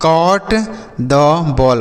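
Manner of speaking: drawn-out, word by word; very slow example. Shown as a demonstration. Teacher reads example slowly to children, drawn out. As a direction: stretch every word for learners. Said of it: "जैसे".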